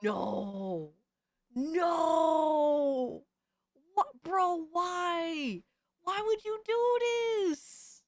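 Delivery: long drawn-out, rising wail of protest; dismayed wail. Real, not acted. Streamer protesting what he was just shown, dismayed wail. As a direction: climb into a high, pleading whine.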